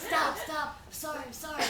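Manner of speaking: mock anxious pleading; retold frantic pleading. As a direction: beg someone running away to stop. Pleading behind fleeing brother, retold.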